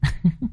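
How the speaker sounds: short high, soft giggle; girlish giggle. A narrator's reading. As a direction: giggle lightly in a young woman's voice. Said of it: "cười khúc khích". (tiếng cô gái cười khúc khích)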